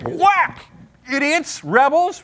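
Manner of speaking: high mock outburst, rebels punched; mad Moses. Teacher acting Moses striking the rock at the people, mock-angry. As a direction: snap it out like a man at the end of his rope, hammed-up. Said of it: "He's mad".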